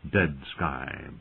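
ordinary delivery, nothing marked

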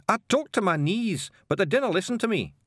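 high-pitched Scots excuse; odd bearded Scotsman, apologising; apologetic Scottish stranger. Acted. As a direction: explain yourself in a high, broad Scottish voice. Stranger excuses his knees.

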